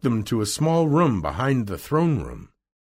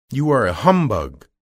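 loud, raised accusation; crying out; 4 semitones higher, 7 dB louder than usual. A narrator's reading. Scarecrow accusing the old man, crying out. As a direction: call him out loudly. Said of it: "cried the Scarecrow".

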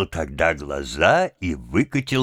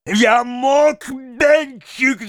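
very high, frenzied yell; all-out shout; 4 dB louder, much slower than usual. A narrator's reading. Mendel yells at his son with all his strength, all-out shout. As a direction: scream it with all your might. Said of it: "закричал он изо всех сил".

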